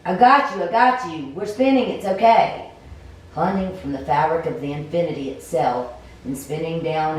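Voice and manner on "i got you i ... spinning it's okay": high loud urgent calls, then reassuring; urgent shout. Acted. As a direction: shout to hold on while catching someone. Catching a falling stranger.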